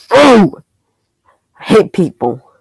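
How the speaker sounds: high, sharp cry of annoyance; real gamer exasperation; frustrated outburst. Real, not acted. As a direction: burst out in frustration at the game. Frustrated outburst during game.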